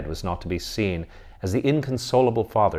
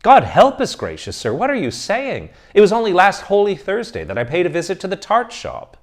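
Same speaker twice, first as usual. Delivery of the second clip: high, loud flustered pleading; a seminarian horrified at the praise; alarmed protest; 11 semitones higher, 8 dB louder than usual. Acted. Student protests, much higher voice.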